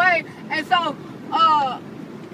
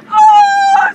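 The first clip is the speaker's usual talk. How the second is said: high, loud shocked oh; surprised shout; 13 dB louder than usual. Real, not acted. Kid shouting in shock as the story twists. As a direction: yelp at the twist.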